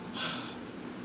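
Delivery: long soft breathy exhale; drained sigh; tired sigh. Real, not acted. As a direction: let out a weary sigh. Exhausted while cooking.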